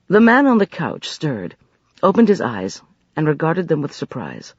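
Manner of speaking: usual speaking voice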